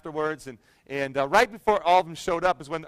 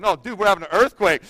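urgent correction; 4 dB louder than usual. Retold or imitated. Pastor correcting his too-casual brother-in-law, mildly alarmed. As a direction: insist on it with retold urgency. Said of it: "he said it so casually".

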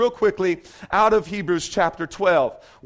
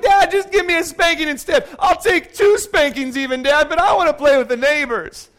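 put-on high, louder pleading little girl; begging daughter; 7 semitones higher, 4 dB louder than usual. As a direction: whine and beg for a spanking instead. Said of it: "She was like".

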